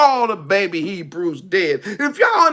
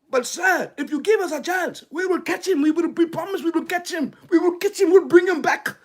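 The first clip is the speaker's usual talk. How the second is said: pleading soldiers; 6 semitones higher, 3 dB quieter, faster than usual. Acted. Soldiers begging Pharaoh.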